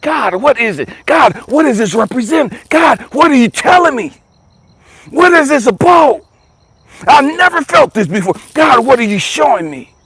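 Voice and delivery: crying out to God. Retold or imitated. Shaken man pleading with God over a strange chill, crying out. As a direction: call out to God, shaken and searching.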